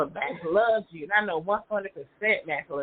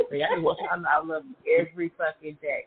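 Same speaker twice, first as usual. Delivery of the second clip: ordinary delivery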